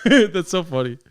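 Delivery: laughing through the words. Real, not acted. Streamer laughing at the podcast clip, laughing through it.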